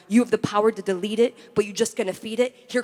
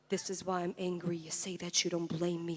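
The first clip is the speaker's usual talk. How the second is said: low, soft poetic chant; hushed refrain; 3 semitones lower, 11 dB quieter than usual. Acted. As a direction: hold the anger down, barely voiced. Hushed first take of the refrain.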